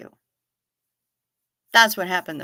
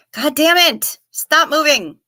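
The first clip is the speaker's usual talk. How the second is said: a sudden burst of annoyance; exasperated; 6 semitones higher, 4 dB louder than usual. Real, not acted. Streamer scolding a thing to stop moving, exasperated outburst. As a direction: exclaim it, higher-pitched and exasperated.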